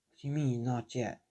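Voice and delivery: soft, puzzled query; subdued questioning. Acted. Injured Deku questions the voice in his head, subdued. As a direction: ask it quietly, as if to yourself.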